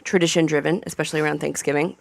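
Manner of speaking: usual speaking voice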